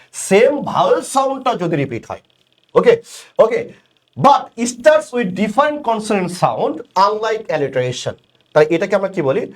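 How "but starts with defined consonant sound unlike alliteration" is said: slow reading. Shown as a demonstration. Reading definition slowly.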